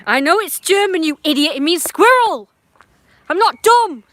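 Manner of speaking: high, loud angry outburst; exasperated shouting; indignant yelling. Real, not acted. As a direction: yell back, offended, at the top of your voice. Yells back an indignant insult.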